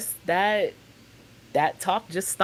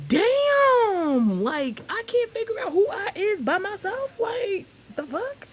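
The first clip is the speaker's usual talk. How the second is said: shocked; 13 semitones higher, slower than usual. Retold or imitated. Shocked at mom telling sister.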